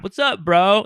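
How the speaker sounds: high, breezy bro greeting. Retold or imitated. Landlord greeting his tenant, high breezy bro voice. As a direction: play the chill bro landlord. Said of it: "That's how he talks".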